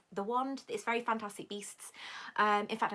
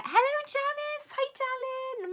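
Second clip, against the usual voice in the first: high-pitched delighted hello; excited greeting; 5 dB louder than usual. Real, not acted. Streamer spots her editor friend in chat, excited greeting. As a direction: squeal hello to a dear friend, much higher.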